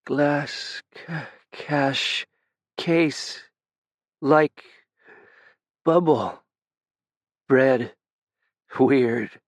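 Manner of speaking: mild slur, halting and broken; slurring words. A narrator's reading. Dying Ben tries to tell Tenzin about the glass, mildly slurred. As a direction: stumble over the words as if drifting off. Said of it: "He started to slur his words again".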